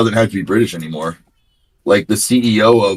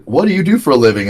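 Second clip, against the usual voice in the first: higher, quick mimicked street question; eager interviewer; 7 semitones higher, 3 dB louder, faster than usual. Storyteller mimics a YouTuber approaching drivers, eager.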